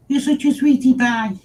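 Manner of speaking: high, sing-song cooing. Real, not acted. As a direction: coo it at a kitten. Cooing at the cat.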